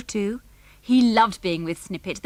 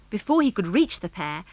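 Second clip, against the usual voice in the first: plain reading voice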